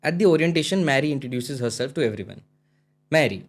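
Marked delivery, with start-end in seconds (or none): none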